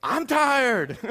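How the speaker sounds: high mock-exhausted wail; imitating exhausted wife. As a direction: mimic a worn-out wife whining back. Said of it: "she looks at me and goes".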